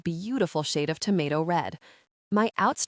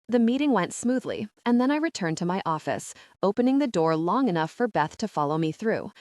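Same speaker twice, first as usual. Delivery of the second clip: ordinary delivery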